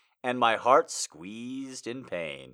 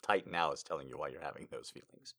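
ordinary talk, nothing marked

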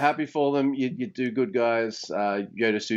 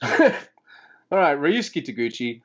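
ordinary talk

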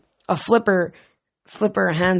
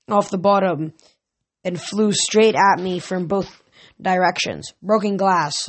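ordinary delivery

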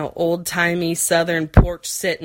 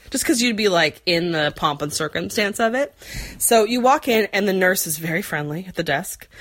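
usual speaking voice

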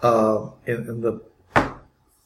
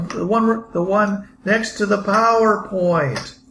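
survivor voice; 7 semitones higher, slower than usual. Acted.

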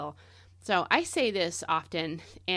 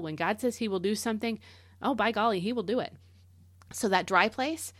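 ordinary delivery

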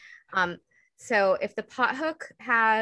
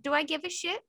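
exasperated mom; 8 semitones higher than usual. Pretend. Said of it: "And I'm like".

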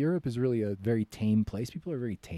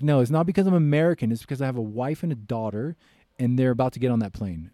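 firm retort; 3 semitones higher, 7 dB louder than usual. Retold or imitated. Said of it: "what I told him".

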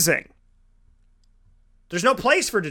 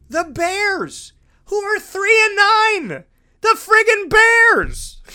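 high, drawn-out cry of disbelief; exasperated outburst; 10 semitones higher, 3 dB louder, much slower than usual. Real, not acted. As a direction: build to an incredulous, slow high shout. Outraged rant at narrow win over Bears.

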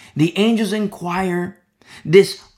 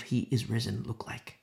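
breathy hushed whisper; a secret shared in awe; 6 semitones lower, 8 dB quieter than usual. Real, not acted. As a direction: lean in and whisper it. Preacher drops to a whisper.

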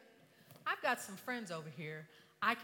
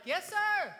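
high, loud call back; shouted reply; 12 semitones higher, 11 dB louder than usual. Retold or imitated. Stranded woman answering the yacht owner, shouted reply. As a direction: holler the answer back over the water.

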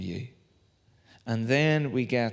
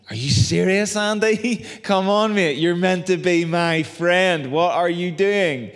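louder, higher, laughing protest at a friend; hammed-up indignation, slowing on "my friend"; mock outrage; 6 semitones higher, 5 dB louder, slower than usual. Pastor protests to his friend Andy, loud laughing mock outrage. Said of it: "And I'm like".

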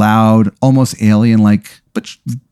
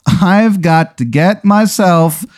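higher, very slow, drawn-out self-talk; talking to himself; 7 semitones higher, much slower than usual. Acted. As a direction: stretch each word as a bemused note to self. Character talks himself out of vision.

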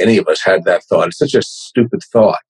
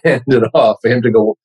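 ordinary talk

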